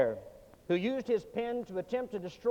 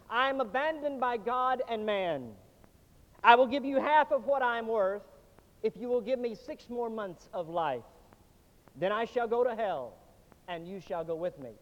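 usual speaking voice

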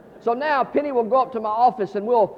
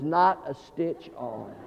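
ordinary talk, nothing marked

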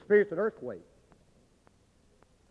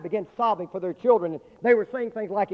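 normal talking voice

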